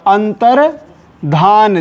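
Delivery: अंतर्धान slowly, split before "dhaan"; slow antar, pause, dhaan in अंतर्धान; careful correct form. Shown as a demonstration. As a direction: dictate अंतर्धान in two slow parts. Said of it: "ध्यान दीजिएगा गलती ना होने पाए".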